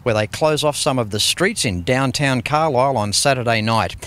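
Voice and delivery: upbeat show-closing announcer read; a lightly voiced TV presenter over music; TV host sign-off. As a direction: wrap up the episode like a car-show presenter.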